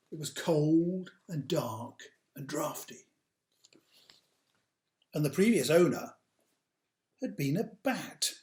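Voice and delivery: put-on slow, dramatic read-aloud for children; storybook narration. A narrator's reading. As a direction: tell it like a bedtime tale, big and grand.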